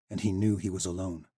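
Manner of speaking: plain reading voice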